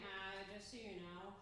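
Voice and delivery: a hint of a patronizing date; lightly voiced smug boyfriend. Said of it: "he says to me".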